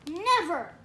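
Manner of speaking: loud, defiant outburst; defiant refusal. A narrator's reading. Angry boy refusing to accept a new baby, defiant.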